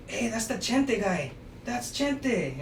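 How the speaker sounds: lightly acted shout-out; calling out recognition. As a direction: imitate someone pointing out a local character. Acting people spotting him by nickname.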